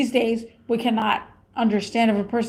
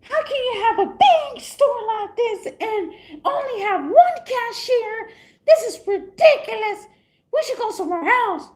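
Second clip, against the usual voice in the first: high, laughing mock outrage; grandma freaking out; 10 semitones higher than usual. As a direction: squeal it like an indignant grandma. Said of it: "She freaked out".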